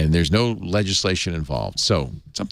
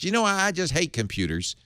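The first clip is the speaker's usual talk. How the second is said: higher-pitched, lightly voiced judge; lightly put-on judge with a higher voice; imagined judge; 7 semitones higher, 3 dB quieter than usual. Judge grumbling about technology, in a lightly put-on higher voice. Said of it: "No, he doesn't talk like that".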